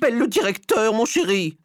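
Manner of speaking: high, loud, put-on lady's voice; scandalised high-voiced order; indignant wife. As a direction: demand it way up in pitch. Outraged wife appeals to husband.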